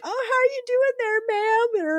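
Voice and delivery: high, fast sugary customer voice; customers misgendering. Retold or imitated. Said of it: "they're like".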